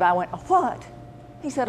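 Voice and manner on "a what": surprised. Retold or imitated. Hearing an unknown term.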